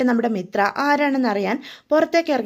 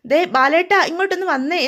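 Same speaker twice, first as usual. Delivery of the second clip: raised voice calling her husband over, pitched up; mild retold call, higher and louder; 6 semitones higher, 4 dB louder than usual. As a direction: call across the house for Balan to come. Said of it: "വിളിച്ചു പറയണേ".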